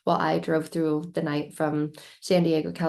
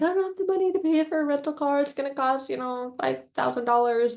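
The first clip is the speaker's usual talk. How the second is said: much higher, fast, teary pleading; bawling; 9 semitones higher than usual. Retold or imitated. Stranded daughter crying to mom on the phone, high teary panic. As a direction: blurt it out in a mock crying panic. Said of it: "crying, bawling".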